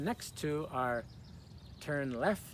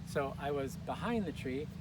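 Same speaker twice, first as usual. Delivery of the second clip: ordinary delivery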